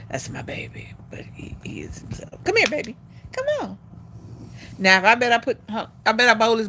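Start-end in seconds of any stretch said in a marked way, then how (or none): 0.1-2.4 unvoiced whispered aside. Real, not acted. Telling viewers about her dog Jax, whispered. 2.4-3.8 high sing-song come on to the dog; high coaxing call. Real, not acted. Calling Jax over to her, high coaxing.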